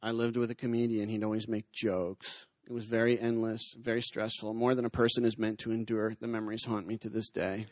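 low, weary impersonation of a long-suffering woman; mock-traumatized, low and subdued; doing her voice. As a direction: recount it like a haunted survivor. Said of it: "I'm gonna have to do the voice".

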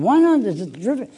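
high, loud startled why not; lawyer's startled disbelief; surprised question. Retold or imitated. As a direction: jump up in surprise at the request. Attorney questions his odd request.

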